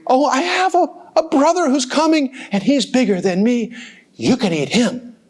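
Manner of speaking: high, eager little goat voice; little billy goat. Acted. Little goat offering his brother instead, high and eager. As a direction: talk your way out, small and high.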